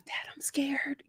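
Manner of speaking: imitating a frightened girl whispering; hushed, breath-only plea to a father; scared whisper. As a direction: whisper it as if hiding from intruders. Retold daughter hiding under bed.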